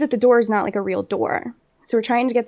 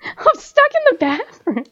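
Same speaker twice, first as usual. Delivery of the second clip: much higher put-on voice; retold helpless plea; 13 semitones higher than usual. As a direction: plead in a high mock-helpless voice. Acted plea to angry dad.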